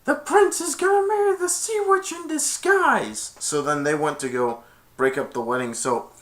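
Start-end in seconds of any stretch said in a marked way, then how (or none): 0.0-3.3 very high panicked squawk; freaking out. Retold or imitated. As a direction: blurt it out in high-pitched, frantic alarm. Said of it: "like, freaking out and said".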